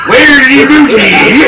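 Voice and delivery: loud, slow pirate yell; pirate shout. Pretend. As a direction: bellow it like a pirate.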